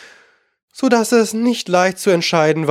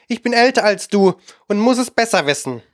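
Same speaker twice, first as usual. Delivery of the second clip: a higher, grumbling parrot who insists he knows best; grumpy parrot; 6 semitones higher than usual. A narrator's reading. Parrot grumbling at Alice, ending the argument, a higher grumbling voice. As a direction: grumble like a stubborn old bird. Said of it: "der zuletzt brummig wurde".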